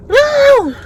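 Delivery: high, drawn-out wail of no; dismayed cry. Real, not acted. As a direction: cry out in real dismay at a spill.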